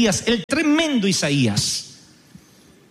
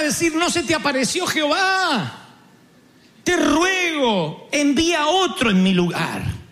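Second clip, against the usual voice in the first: pleading Moses; 6 semitones higher than usual. Retold or imitated. Moisés ruega a Dios.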